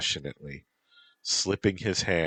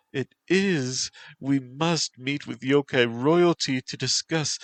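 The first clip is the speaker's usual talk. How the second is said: high, heightened mistress voice; breaking into passion; 10 semitones higher, 4 dB louder than usual. Acted. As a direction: insist urgently, pitched up high. Mistress insisting amid passion.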